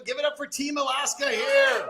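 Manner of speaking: rally the crowd like a cheerleader; cheering call. Real, not acted. Auctioneer hyping the crowd for Team Alaska, cheering shout.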